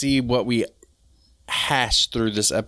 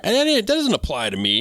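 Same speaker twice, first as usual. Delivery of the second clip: high-pitched mimicked brush-off; mock dismissive; 10 semitones higher than usual. Voicing a listener who thinks it's not for them, mock dismissive. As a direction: imitate a listener waving it off, pitched up.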